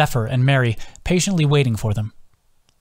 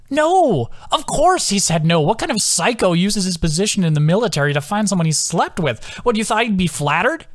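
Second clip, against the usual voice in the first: high, laughing disbelief; incredulous; 7 semitones higher than usual. Acted. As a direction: exclaim in amused outrage. Adam laughing, incredulous retelling.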